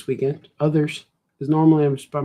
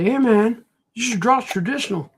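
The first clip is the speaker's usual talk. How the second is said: imitating people; 8 semitones higher than usual. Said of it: "People say".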